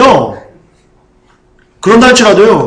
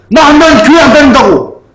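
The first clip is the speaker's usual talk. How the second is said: higher, louder petulant threat; mock sulky threat; 7 semitones higher, 3 dB louder than usual. Preacher mimicking a believer threatening to quit church, petulant. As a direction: play a sulking believer threatening God.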